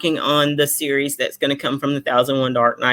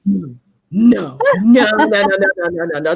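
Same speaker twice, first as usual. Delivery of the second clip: disbelief; 3 semitones higher, 4 dB louder than usual. Retold or imitated. Acted disbelief at the email.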